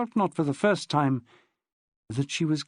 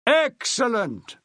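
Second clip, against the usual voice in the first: slow, louder and higher boom; 8 semitones higher, 3 dB louder, slower than usual. Acted. Maxwell to hungover Pansy, booming. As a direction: boom it out like a stage actor. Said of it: "boomed Maxwell".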